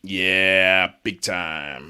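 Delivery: low, drawn-out put-on voice; put-on character voice. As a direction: do a low, goofy character impression. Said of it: "thought you were gonna say Kermit".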